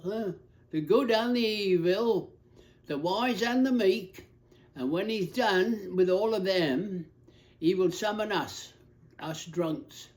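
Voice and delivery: put-on befuddled old drunk preaching in broad Cockney; Cockney accent. Derelict drunk telling the whole bar of God's forgiveness, befuddled Cockney. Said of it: "forgive me if I read it in a Cockney accent".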